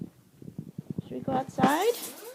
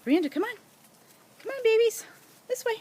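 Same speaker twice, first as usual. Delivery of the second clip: high loud coaxing call; 8 semitones higher, 5 dB louder, much faster than usual. Real, not acted. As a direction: call the pups over, bright and loud. Calling puppies.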